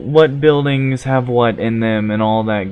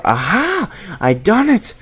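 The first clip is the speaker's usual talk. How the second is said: triumphant; 8 semitones higher than usual. Real, not acted. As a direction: cheer it out, thrilled. Solves the puzzle.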